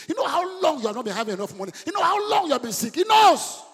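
high shouted sermon with long held peaks; full-force preaching. Real, not acted. Preacher assuring strugglers that Jesus knows, high full-force preaching. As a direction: cry out each repeated line to the congregation.

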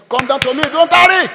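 high, very loud call; shouted summons. Acted. Joseph summoning his father to Egypt, rising shout. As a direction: call out the message, climbing to a shout.

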